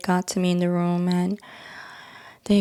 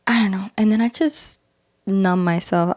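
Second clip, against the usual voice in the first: usual speaking voice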